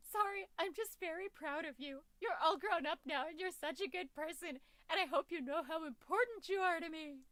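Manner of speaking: lightly faked tears in the voice; mock crying. Proud dad tearing up over his daughter, mock teary. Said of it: "Gonna cry".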